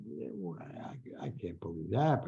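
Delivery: half-whispered, low aside; doubtful muttering. Retold or imitated. Writer recalls doubting the airport rumor, muttering under his breath. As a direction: mumble it to yourself, barely voiced.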